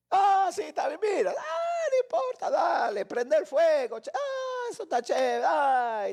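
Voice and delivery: high-pitched, hammed-up euphoria; giddy happiness. Happy person agreeing to anything, giddy and carefree. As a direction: squeal along as if anything goes. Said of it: "cuando estás feliz, aceptas cualquier cosa".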